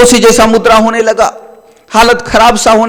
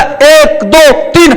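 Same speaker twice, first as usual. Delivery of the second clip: high tense counting before tears; anxious counting; 6 semitones higher, much slower than usual. Acted. Friend counts in front of the saint, high and anxious. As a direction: count as if dreading the third.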